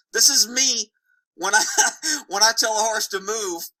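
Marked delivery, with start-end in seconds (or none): 1.7-2.2 quick higher-pitched chuckle; brief laugh breaking into the sentence; short laugh. Real, not acted. Laughs mid-sentence at own example.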